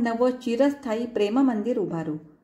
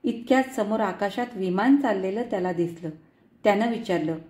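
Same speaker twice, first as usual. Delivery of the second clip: usual speaking voice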